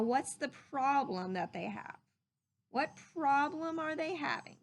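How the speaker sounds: usual speaking voice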